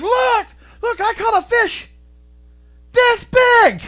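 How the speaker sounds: high, loud gleeful bragging; mock excited boast. Preacher mimicking a proud fisherman, high excited boast. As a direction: show off the catch like a proud kid.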